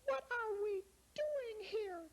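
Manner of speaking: put-on very high, worried little girl; tiny lost girl, close to tears. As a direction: squeak it like a lost child. Voicing little girl Lara.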